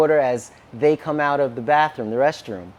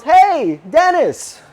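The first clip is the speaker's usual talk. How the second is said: high, raised call across a big room; imitating a friendly hail from far off; 10 semitones higher, 4 dB louder, much slower than usual. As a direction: call the name out to someone far away. Elder calls across huge sanctuary.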